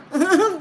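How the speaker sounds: loud squealing laugh; shrieking giggle; high laugh. Real, not acted. Laughs after heck yeah.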